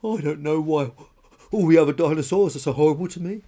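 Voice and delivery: tearful wailing. Acted. Lonely spiky dinosaur cries by herself, weeping. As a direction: wail it out, hurt and self-pitying. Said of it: "she wept".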